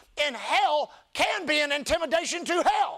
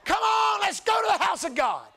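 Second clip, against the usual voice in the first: high, loud, pushy shout; pretend urgent shout; 9 semitones higher, 4 dB louder than usual. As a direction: drag a friend to church, shouting. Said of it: "shake them violently and say".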